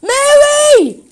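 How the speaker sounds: high, loud anguished scream of a name. A narrator's reading. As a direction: shriek the name across the pen. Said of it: "screamed Jeff".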